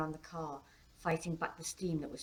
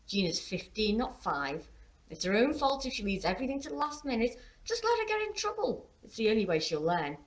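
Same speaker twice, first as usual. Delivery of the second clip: louder scolding, climbing high mid-rant; Claire, lecturing; 6 semitones higher, 5 dB louder than usual. A narrator's reading. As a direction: lecture sternly, pitch rising as it builds. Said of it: "Claire would lecture".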